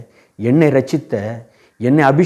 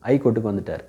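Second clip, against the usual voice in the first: whispered aside; 3 semitones lower, 7 dB quieter, faster than usual. Real, not acted. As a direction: drop to a hushed whisper on the reveal. Judge promoted, whispered aside.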